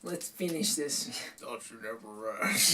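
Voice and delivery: low menacing villain voice; slow, dark threat; scary voice. Retold or imitated. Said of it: "[name]'s scary voice".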